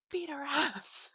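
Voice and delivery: slow, high mock urging; egging-on friends. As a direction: imitate friends goading her into a fight. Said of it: "they were like".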